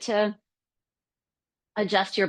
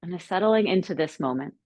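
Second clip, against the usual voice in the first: calm, unhurried settling-in guidance with long pauses; guided meditation. Acted. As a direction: guide them gently into stillness, leaving space between phrases.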